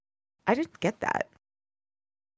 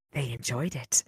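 much softer, low, creeping whisper; 8 semitones lower, 12 dB quieter than usual. Acted. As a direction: breathe it like a lurking thing in the dark. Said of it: "came the whispering voice".

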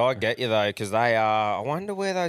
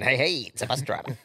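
laughing punchline; 5 semitones higher, faster than usual. Retold or imitated.

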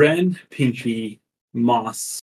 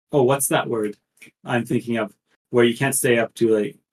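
ordinary delivery

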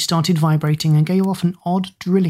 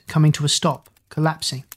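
plain reading voice

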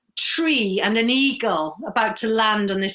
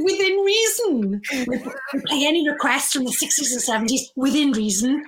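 imitating Phil; 3 semitones higher than usual. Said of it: "the way he said".

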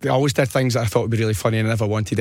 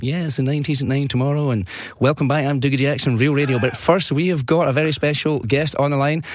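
upbeat radio presenter voice, climbing higher toward the guest's name; 4 semitones higher than usual. Retold or imitated. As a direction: play a cheesy radio host welcoming a special guest. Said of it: "pretended to be this presenter".

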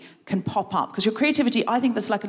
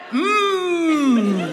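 hummed noise; 5 semitones higher, 4 dB louder than usual. Shown as a demonstration. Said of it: "what if you just went".

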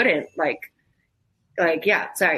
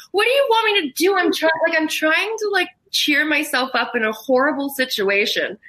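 much higher, exasperated retort; high-pitched pleading self-justification; defensive protest; 8 semitones higher than usual. Retold or imitated. Justifying the joking to a sister, high and defensive.

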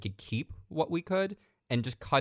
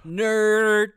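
teasing shout; 11 semitones higher, 10 dB louder than usual. Real, not acted. Teasing jab at MIT.

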